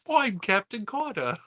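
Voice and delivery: high, hammed-up fake British accent; mock a bad British accent as Captain Carter. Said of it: "she does it in a really bad British accent".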